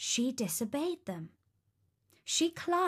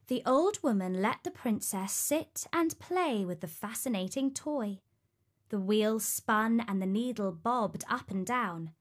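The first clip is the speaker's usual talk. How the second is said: ordinary delivery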